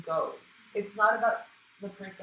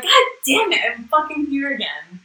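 higher, louder frustrated outburst; mock exasperation; 8 semitones higher, 13 dB louder than usual. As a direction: groan it out, fed up but joking. Exasperated at old pattern.

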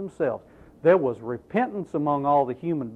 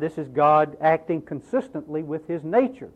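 normal talking voice

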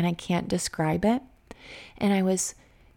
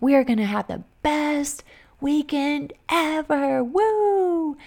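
higher voice rising to a squealed woo; giddy excitement; 7 semitones higher, slower than usual. Retold or imitated. Sisters planning a kid-free weekend, high and giddy. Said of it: "So we were like".

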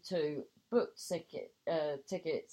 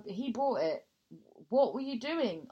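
mild bemused question; 3 semitones higher, 4 dB louder than usual. Retold or imitated. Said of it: "I inquired, somewhat bemused".

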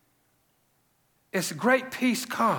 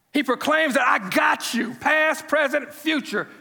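preaching shout; 5 semitones higher than usual. Acted. Preaching climax.

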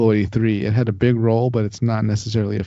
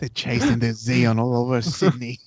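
mock Australian accent. Cohost spinning a gag about Sydney, mock Australian accent. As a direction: narrate like an Australian bloke. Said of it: "we have to do it in Australian accents".

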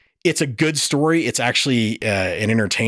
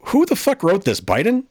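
incredulous outburst; 7 semitones higher than usual. Retold or imitated. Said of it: "And I went".

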